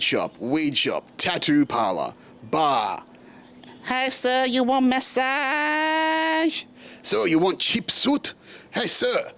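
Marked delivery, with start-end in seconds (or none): 3.8-6.6 high, drawn-out sing-song tout call; massage tout. Retold or imitated. Street tout calling out to a passing tourist, high sing-song. Voicing street touts on Walking Street. 7.0-9.3 high-pitched mimicked street seller; suit tout. Suit seller hailing a tourist in Patong, high mimicked tout voice. Voicing street touts selling suits.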